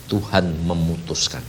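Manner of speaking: ordinary talk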